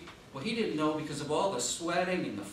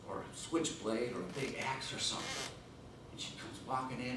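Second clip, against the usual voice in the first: usual speaking voice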